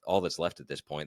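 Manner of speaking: ordinary talk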